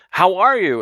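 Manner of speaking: lifted, joyful retold greeting; happy greeting. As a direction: imitate someone happily asking after an old friend. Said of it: "he says it, says it with ha-, happiness".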